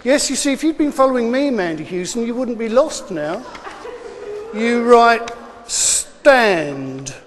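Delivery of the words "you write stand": slow dictation. Real, not acted. Dictating a spelling test word.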